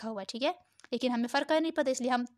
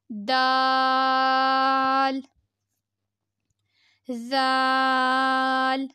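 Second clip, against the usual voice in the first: letter names held long, level tone; drawn-out letters; much slower than usual. Shown as a demonstration. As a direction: sustain each vowel evenly like a recitation drill. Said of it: "پانچ انگلیوں کے برابر کھینچیں گے".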